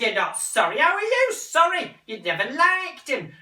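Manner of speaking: high, loud, indignant; angry mother. Acted. Mother rounding on son, high and angry. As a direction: hit back at him loudly.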